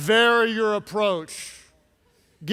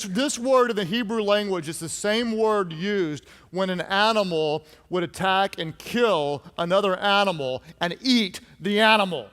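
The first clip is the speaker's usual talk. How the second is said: ordinary talk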